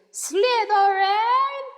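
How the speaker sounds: very loud, high, slow shriek; magic hat screams the house name; hat screaming. A narrator's reading. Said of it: "when it screamed".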